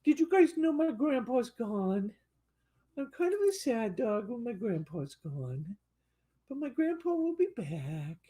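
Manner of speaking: high, sad little puppy voice; voicing the dog. Owner voicing the poodle missing his grandpa, high and pouty. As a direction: pout like a lonely pup. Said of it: "He said".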